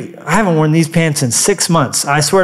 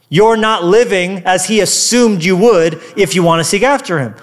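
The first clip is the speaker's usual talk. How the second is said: ordinary talk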